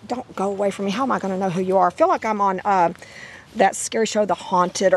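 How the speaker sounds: ordinary talk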